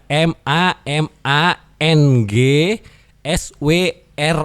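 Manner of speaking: slow, letter by letter; spelling out slowly. Shown as a demonstration. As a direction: spell it clearly for them. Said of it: "Mamang Suara". (mengeja pelan huruf demi huruf)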